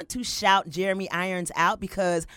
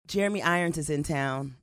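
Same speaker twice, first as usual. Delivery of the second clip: ordinary delivery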